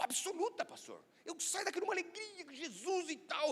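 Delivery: high, animated churchgoer; entusiasmo encenado. Retold or imitated. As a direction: imitate a keen believer, pitch leaping up. Enthusiastic churchgoer's answer, acted. (imitando uma fiel toda animada)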